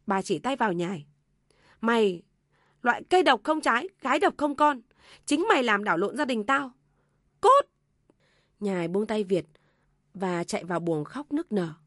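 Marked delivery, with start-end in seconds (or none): 1.8-7.7 loud, high venomous mother-in-law; furious cursing. A narrator's reading. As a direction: hurl abuse, ending on a yelled dismissal. Mother points, curses daughter-in-law. (mẹ chồng quát mắng, xua đuổi cay nghiệt)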